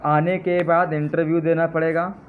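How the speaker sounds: ordinary delivery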